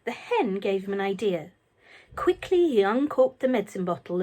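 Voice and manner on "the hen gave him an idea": ordinary delivery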